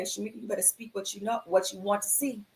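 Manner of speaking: soft, quick, otherworldly inner voice; a hushed inner voice of guidance; voice of Spirit. Retold or imitated. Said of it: "I hear Spirit say".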